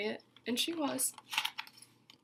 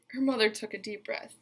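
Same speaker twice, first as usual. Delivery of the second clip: ordinary delivery, nothing marked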